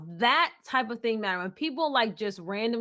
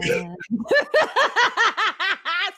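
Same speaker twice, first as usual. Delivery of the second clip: a high laugh that climbs; high rising laugh; 10 semitones higher, 5 dB louder than usual. Real, not acted. Laughing at herself after singing the song.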